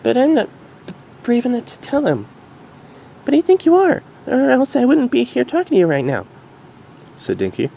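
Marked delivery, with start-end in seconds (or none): 0.0-2.3 higher, gentle mare voice; reassuring Derpy. Acted. Derpy hugging Dinky, gently reassuring. 3.2-6.3 very high filly voice; curious filly. Acted. Dinky asking her mom, curious filly.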